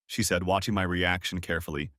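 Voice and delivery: ordinary delivery, nothing marked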